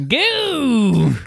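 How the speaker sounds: sudden high splutter; spray out a drink mid-laugh; spit-take splutter. Real, not acted. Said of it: "[name] just spit out his high C".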